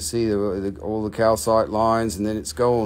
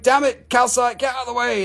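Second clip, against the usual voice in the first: quartz voiced; 11 semitones higher, 3 dB louder than usual. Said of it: "the quartz is like".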